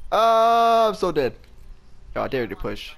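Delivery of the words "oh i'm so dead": high, loud wail of doom; panicked yell. Real, not acted. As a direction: cry out that you're finished. Teammates died, panic.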